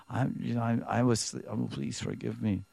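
meek apology. Acted. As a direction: shrink down and beg softly.

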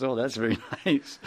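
lightly voiced Krishna, amused, laughing through it; a chuckling, delighted reply; a hint of amused Krishna; pleased Krishna. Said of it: "And Krishna says".

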